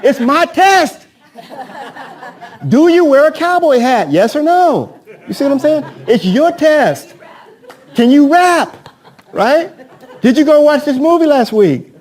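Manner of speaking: hammed-up silly quiz questions, cracking up; laughing joke. Trainer jokes to the trainees about his own rigged quiz, laughing high and loud.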